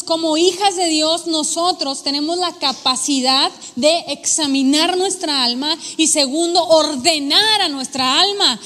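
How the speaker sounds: ordinary delivery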